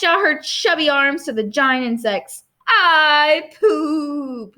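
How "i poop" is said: put-on drawn-out, high little-child announcement; gracious toddler. A narrator's reading. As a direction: stretch it out grandly like an admired toddler.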